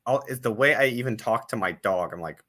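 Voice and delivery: normal talking voice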